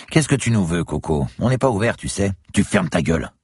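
hostile barmaid. A narrator's reading. Bar woman to an armed intruder, hostile. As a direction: snap at an unwelcome intruder.

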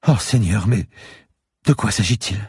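put-on high, startled old lady; alarmed old woman. A narrator's reading. Old lady discovering the unmarked CD-ROMs, alarmed. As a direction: gasp it out as a worried old woman.

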